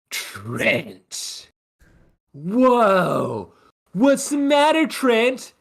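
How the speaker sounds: high, sneering bully voice; taunting rival. Acted. Rival band taunts Trent.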